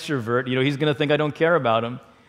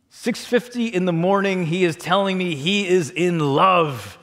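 normal talking voice

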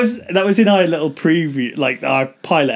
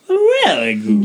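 swooping much higher; mock fluctuation; much slower than usual. Host mocks a friend's love of voice fluctuation, swooping high. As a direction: overdo the wobbly voice.

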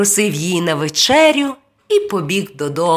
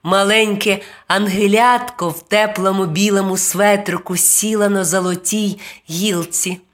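ordinary delivery

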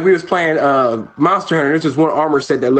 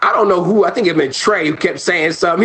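ordinary delivery

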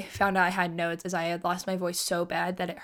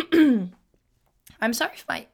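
voice crack; 3 semitones higher, 7 dB louder than usual. Real, not acted. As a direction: voice cracking up high. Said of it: "oh, that was not cute".

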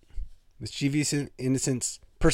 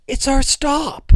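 much higher, slow and thrilled; excited Pecker voice; 13 semitones higher, 5 dB louder, slower than usual. Retold or imitated. Imitating Pecker excited at his bus stop, much higher and slow. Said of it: "I can't really voice it well, but he goes".

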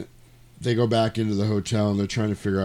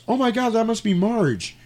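high-pitched exclamation of alarm; retold gasping realisation, pitched way up; mock shock; 13 semitones higher, 3 dB louder than usual. Woman seeing the body wheeled out, mock shock.

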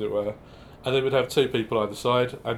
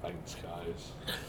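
deadpan quiet; 6 semitones lower, 15 dB quieter than usual. Retold or imitated. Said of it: "So like".

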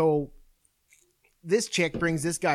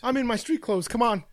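mock protest; 8 semitones higher than usual. Acted protest at suggested rescue.

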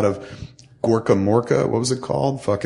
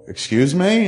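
taken aback, pitched high; retold disbelief; 9 semitones higher, slower than usual. Customer to the bank on the phone, retold disbelief. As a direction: react with startled, higher-pitched incredulity. Retold shock at bank's news.

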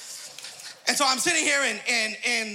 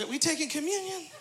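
wry question; 4 semitones higher, 5 dB quieter than usual. Retold or imitated. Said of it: "And I said".

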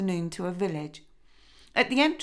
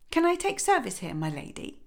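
put-on timid girl; 8 semitones higher than usual. A narrator's reading. Princess asks for work.

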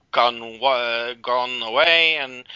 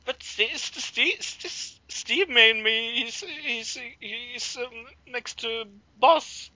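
higher, stammering near-tears plea; almost crying; 10 semitones higher than usual. Retold or imitated. Cal pleading about Steve, near tears. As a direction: choke on the name, close to crying. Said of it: "Almost like that".

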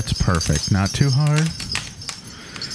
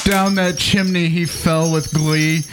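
poem recital; 4 semitones higher than usual. Acted. Comic reading a Christmas poem to co-hosts, mock-solemn recital. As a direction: recite it like a sacred holiday verse.

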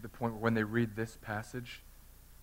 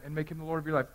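usual speaking voice

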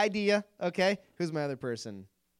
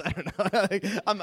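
normal talking voice

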